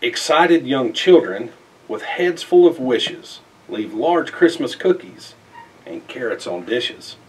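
plain reading voice

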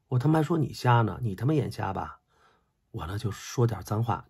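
ordinary delivery

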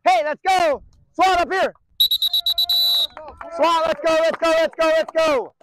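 loud, high coach's rallying call; hurrying shout. Real, not acted. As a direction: yell to get them moving.

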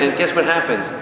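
usual speaking voice

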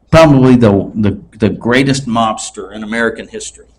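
normal talking voice

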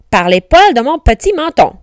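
loud, lively defiant pig; firm storybook retort; defiant refusal. A narrator's reading. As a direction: refuse with bouncy emphasis. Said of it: "Pris de panique".

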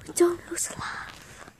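whispered aside; breathy secret whisper. Real, not acted. Aside about toilet rule.

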